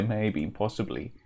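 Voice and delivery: usual speaking voice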